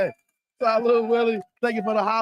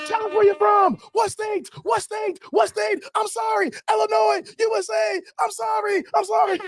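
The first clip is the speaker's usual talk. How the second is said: high frantic shouting; much higher, yelled in a panic; 13 semitones higher than usual. Retold or imitated. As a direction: shout apologies at the guards. Frantic exchange at customs.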